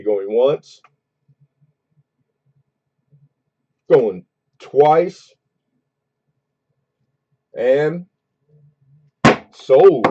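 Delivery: auction call, fair warning, going once, going twice; auctioneer. Real, not acted. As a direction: build up to a punchy sold.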